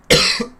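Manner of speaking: one last hard cough; choking cough. Real, not acted. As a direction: clear the throat with a sharp cough. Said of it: "Went down the wrong pipe".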